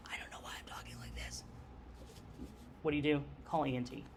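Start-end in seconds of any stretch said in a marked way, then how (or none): 0.1-1.4 breathy, barely voiced whisper of a hoarse patient. Teacher imitates a post-op patient who can only whisper. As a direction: whisper it as a patient whose voice is gone. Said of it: "if they're still talking very quietly".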